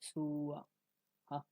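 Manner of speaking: সু আহা very slow, 'suuu-aa-ha'; slow Sanskrit pronunciation. Shown as a demonstration. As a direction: stretch each vowel of সু আহা. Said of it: "একটু সময় নিই". (সু আহা, সময় নিয়ে খুব ধীরে)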